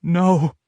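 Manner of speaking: gasped, moaning no; breathy gasp of no; half gasp half moan. A narrator's reading. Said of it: "I half gasp, half moan".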